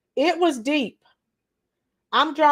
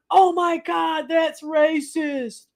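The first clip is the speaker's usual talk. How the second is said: mock offended quote; 3 semitones higher, slower than usual. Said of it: "there was no one".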